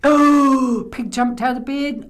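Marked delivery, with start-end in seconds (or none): 0.0-0.9 long, very loud "oh"; startled cry. Acted. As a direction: cry out in sudden alarm. All jump in fright.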